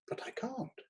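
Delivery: softly whispered protest; timid whisper. A narrator's reading. The shy critic protests to the editor in a whisper. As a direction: breathe it out helplessly.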